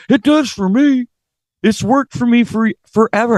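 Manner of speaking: high, far-off easy-going voice; lightly voiced fellow singer; Devin's reply. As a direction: answer breezily as the other singer. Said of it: "he's like".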